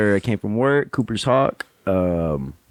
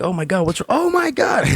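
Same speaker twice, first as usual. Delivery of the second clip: much higher, shrieking oh my God; panicking servers; 13 semitones higher than usual. Retold or imitated. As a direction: squeal it like frantic coworkers. Servers panicking over injury.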